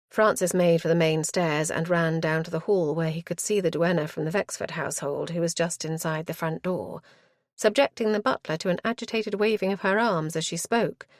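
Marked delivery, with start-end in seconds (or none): none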